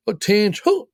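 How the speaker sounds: high rising call to attention; drill command. Retold or imitated. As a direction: snap the unit to attention. First sergeant calling attention.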